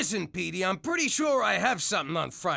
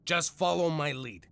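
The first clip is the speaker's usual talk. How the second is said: normal talking voice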